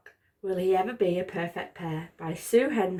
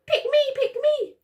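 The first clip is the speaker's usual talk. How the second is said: very high, excited little voices; squeaky eager socks; 4 dB louder than usual. Acted. Socks begging the hand to pick them, high and eager. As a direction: beg to be picked, high and bright.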